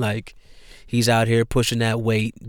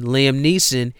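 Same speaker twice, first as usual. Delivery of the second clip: ordinary delivery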